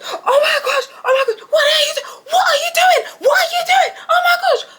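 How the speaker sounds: high, loud shrieking yell with half-laughing cries; panicked scream. Retold or imitated. Toilet screaming at Tony in the bathroom, loud high shriek. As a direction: shriek like someone walked in on you.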